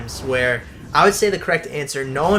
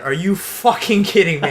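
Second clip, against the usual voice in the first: retold yell; 4 semitones higher, 3 dB louder than usual. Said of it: "I just yelled out loud".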